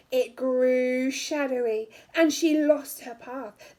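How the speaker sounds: ordinary delivery, nothing marked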